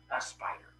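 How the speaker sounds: low, near-whispered punchline; soft, ominous storyteller's hush; hushed reveal. Retold or imitated. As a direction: drop to a whisper for the dramatic reveal. Reveal of the curse after pause.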